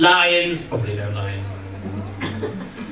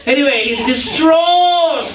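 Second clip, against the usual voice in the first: mock distraught; 5 semitones higher, slower than usual. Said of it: "That's acting".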